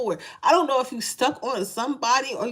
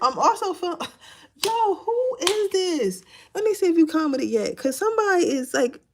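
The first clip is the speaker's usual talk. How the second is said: normal talking voice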